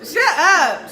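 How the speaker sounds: "Shut up" yelled high and loud through laughter; laughing shout. Retold or imitated. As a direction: crack up as you blurt "Shut up". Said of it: "She's like".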